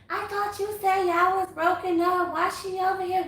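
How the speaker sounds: high-pitched mimicked complaint; overheard complaint, imitated. Imitating an overheard quarrel about a breakup, high-pitched complaint. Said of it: "all I hear".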